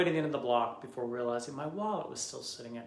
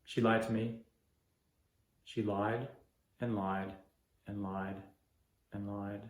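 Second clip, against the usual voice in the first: fading bitterness; 4 semitones lower than usual. Acted. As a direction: let each lied fade lower. Bitter repeated accusation.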